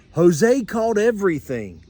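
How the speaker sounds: ordinary delivery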